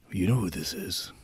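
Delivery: low, hushed mock-seductive tone; creepy prank caller. Prank caller refusing to give a name, mock-sultry. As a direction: keep it low and insinuating.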